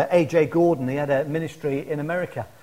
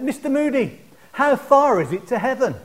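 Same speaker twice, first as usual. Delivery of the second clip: higher-pitched jeer from the crowd, imitated; mock heckler's call; 6 semitones higher than usual. Heckler to a street preacher, imitated mocking call. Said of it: "shout out things that were to put him off".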